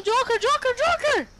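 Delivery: calling out. Real, not acted. Calling teammate in panic.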